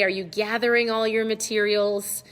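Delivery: normal talking voice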